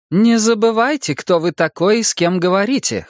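high-pitched, haughty reprimand; cold rebuke. A narrator's reading. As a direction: put the listener sternly in their place. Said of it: "холодно сказала она".

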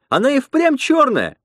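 very high cry of dismay; exclaiming. A narrator's reading. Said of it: "воскликнула Лена".

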